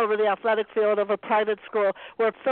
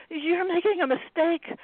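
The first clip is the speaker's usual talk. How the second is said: frightened plea; 8 semitones higher than usual. A narrator's reading. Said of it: "she tried to say".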